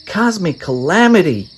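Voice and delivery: put-on eager young hero; Ed's voice. A narrator's reading. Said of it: "Ed said".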